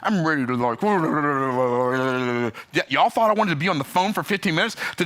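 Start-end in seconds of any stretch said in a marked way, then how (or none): none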